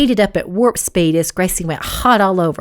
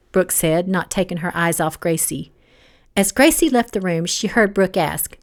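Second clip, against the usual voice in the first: ordinary delivery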